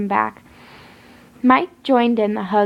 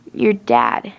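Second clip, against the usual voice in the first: much lower, menacing villain voice; sinister Jeff; 10 semitones lower than usual. A narrator's reading. Jeff taunting the grieving girl, low and menacing. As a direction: drop deep and threatening.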